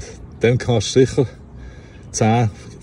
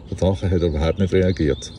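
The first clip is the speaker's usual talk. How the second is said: ordinary delivery, nothing marked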